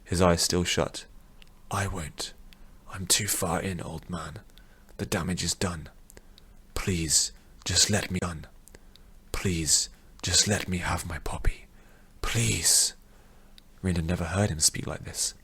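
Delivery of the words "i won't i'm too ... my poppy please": soft, hushed pleading; pinned down and defeated, begging the old man quietly; small, sad voice. Acted. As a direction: beg barely above a whisper, beaten. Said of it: "in a small, sad voice".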